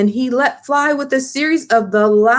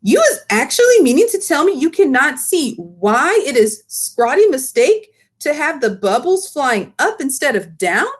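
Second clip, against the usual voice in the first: high, eager giant voice with muddled grammar; the BFG lecturing, indignant; big friendly giant; 4 semitones higher than usual. Acted. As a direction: BFG scolding Sophie, higher and indignant. Giant scolds Sophie.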